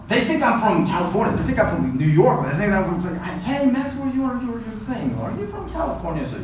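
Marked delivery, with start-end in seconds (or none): 3.4-6.3 pitched-up, eager questioning voice, higher; mock curious American asking; curious American stranger. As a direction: play a nosy stranger asking where he's from. Strangers quizzing him, quoted.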